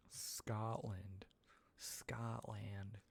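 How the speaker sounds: whispered "Scotland", American vowel as in "Scott", flat "land". Shown as a demonstration. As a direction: whisper "Scotland" slowly, twice, checking the sound. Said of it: "You love the way Americans say Scotland".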